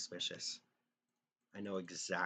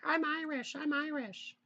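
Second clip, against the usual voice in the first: much higher, squeaky mock girlfriend insisting; imitating his ex-girlfriend insisting she is Irish, much higher and louder; 5 dB louder than usual. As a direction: parody her shrill insistence. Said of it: "She'd be like".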